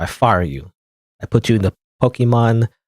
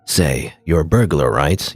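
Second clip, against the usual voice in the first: lower, slower take on Leon, as he should sound; deeper voice; 7 semitones lower, slower than usual. Retold or imitated. Player recasting Leon's line in a deeper voice. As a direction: drop into a deeper tough-guy read. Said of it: "like a deeper voice".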